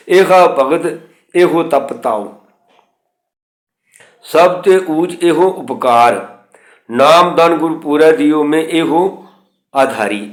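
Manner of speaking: slow, measured reading of sacred verse; scripture recitation. Acted. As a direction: recite it deliberately, word by word. Recites Gurbani verse slowly.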